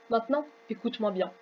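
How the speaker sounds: gangster voice. Acted. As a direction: growl it like a mob boss. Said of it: "ça fait plus voix de gangster que de vieux".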